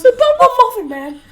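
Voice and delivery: screaming gingerbread man. Retold or imitated. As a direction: shriek it like a gingerbread man losing his hand. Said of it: "I could do a really good gingerbread impression".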